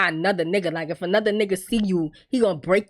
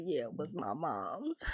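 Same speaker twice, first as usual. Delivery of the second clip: softer and slow, tender; hushed tender reply; 7 semitones lower, 17 dB quieter than usual. Retold or imitated. Man telling his fiancée about the ring, softer and slow. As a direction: say it low, like a confession. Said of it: "He's like".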